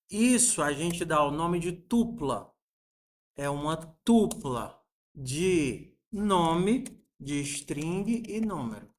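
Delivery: ordinary delivery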